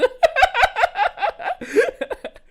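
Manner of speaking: loud, high laugh breaking out; high teary laugh. Real, not acted. Streamer overwhelmed by the video, loud teary laugh. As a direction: let a teary giggle burst out.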